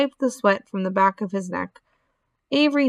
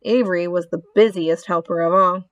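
usual speaking voice

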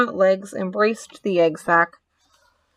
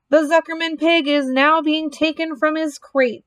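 high, ringing public announcement; pompous fairground announcer; booming loudspeaker announcer; 7 semitones higher than usual. Acted. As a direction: boom it out pompously to the crowd. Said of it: "boomed the voice of the loudspeaker".

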